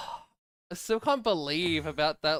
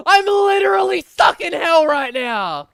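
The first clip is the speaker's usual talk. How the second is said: high, loud yell sliding down at the end; exasperated shout; 10 semitones higher, 11 dB louder than usual. Real, not acted. Streamer stuck in the Nether raging at the game, shouting.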